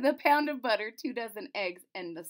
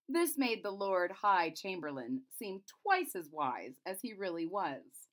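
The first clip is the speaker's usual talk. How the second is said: ordinary delivery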